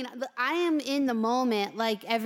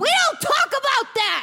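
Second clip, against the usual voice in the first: sudden high-pitched yell; touchy angry outburst; 8 dB louder than usual. Acted. Hamster silencing birds about forbidden subject, angry. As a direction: cut them off in comic fury. Snaps at mention of Bubbles one.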